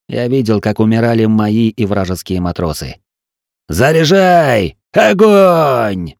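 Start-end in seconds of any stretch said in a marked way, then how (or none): 3.7-6.1 high, slow, drawn-out battle cry; shouted command. A narrator's reading. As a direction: bellow gun orders over the fighting. Captain commands the guns in battle.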